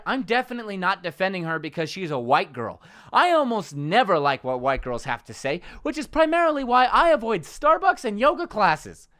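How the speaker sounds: ordinary talk, nothing marked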